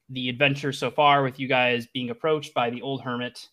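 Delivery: ordinary talk, nothing marked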